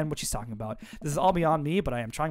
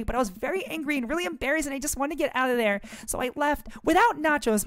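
put-on higher, lively teenage girl venting; higher teen girl voice, irritated; teen daughter voice; 8 semitones higher than usual. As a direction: play the upset teen daughter ranting.

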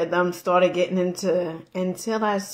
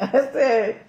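ordinary talk, nothing marked

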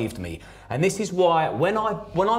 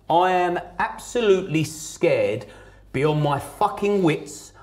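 normal talking voice

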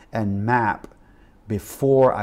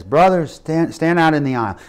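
retold preacher's loud, higher call; 5 semitones higher, 7 dB louder than usual. Preacher singles out a man in the congregation, calling out.

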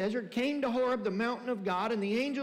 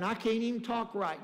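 ordinary delivery

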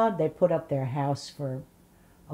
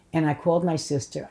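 usual speaking voice

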